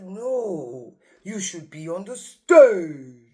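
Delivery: loud, slow theatrical proclamation; Christopher Lee. Retold or imitated. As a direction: declaim it like a grand old actor. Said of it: "he's got a really deep voice".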